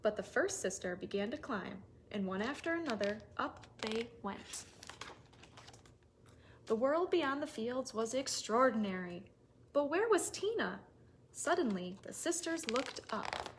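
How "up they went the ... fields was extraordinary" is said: plain reading voice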